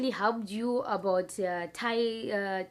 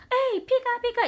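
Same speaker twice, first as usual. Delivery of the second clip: loud, very high-pitched hailing; high, loud mock street call; imitated calling out; 6 dB louder than usual. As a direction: mimic people calling out to each other. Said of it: "calling each other".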